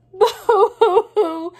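high, loud, slow hammed-up boo-hoo; mock crying. Friends all cry together in slow, high mock sobs. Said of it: "cried Bird's friends together".

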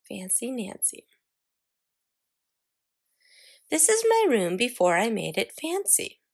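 put-on bright, expressive read-aloud to kids; storybook narration for young children; story reading to children. A narrator's reading. Reading a picture book aloud.

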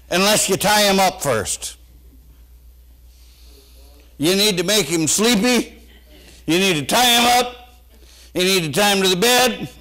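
high, loud fiery preaching. Acted. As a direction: preach it with rising fire. Preaching at full force.

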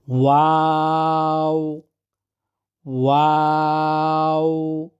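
slow ওয়াও with rounded lips; Arabic waw. Shown as a demonstration. Teacher showing waw with the lips, slow. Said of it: "দুই ঠোঁট দ্বারা উচ্চারণ হয়".

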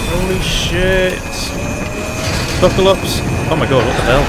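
high, loud panicked screams; panicked yelling. Real, not acted. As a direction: yell in panic as you crash. Helicopter crashing, panicked yelling.

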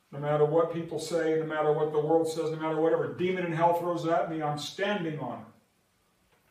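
fast loud preacher's build; preaching crescendo. Acted.

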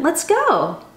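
normal talking voice

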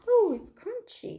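high, slow, delighted Oh, it's crunchy; imitating a friend. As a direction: mimic a friend tasting something crunchy. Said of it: "이렇게 표현했었습니다".

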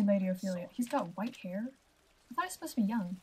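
ordinary talk

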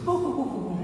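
swooning oh. Retold or imitated. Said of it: "she immediately goes".

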